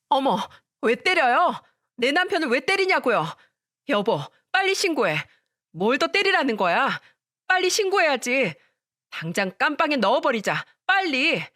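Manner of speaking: 높은 음으로 난리 치며 따지기; 다급하게 남편을 부추기는 동서; 호들갑. A narrator's reading. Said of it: "동서의 호들갑을 보면서". (호들갑스럽게 몰아붙이듯)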